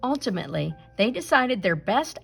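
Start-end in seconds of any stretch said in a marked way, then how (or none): none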